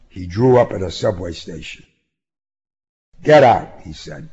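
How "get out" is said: loud blunt command; loud order. A narrator's reading. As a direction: bark it out. Ordering her out.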